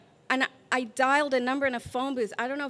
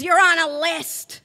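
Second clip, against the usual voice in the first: stern mock shout; 6 semitones higher, 11 dB louder than usual. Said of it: "And he says".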